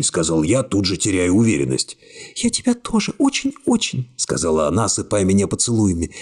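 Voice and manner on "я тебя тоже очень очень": high, sobbing-tinged murmur of love; high tearful young woman. A narrator's reading. Secretary tearfully clinging to the hero, high and weepy. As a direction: voice her high, choking back tears. Narrator voices Iskra.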